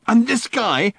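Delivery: high, loud exasperated rant; Ford's high, loud, fed-up rant. Acted. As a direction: rant it out in a fury. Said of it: "ranted Ford".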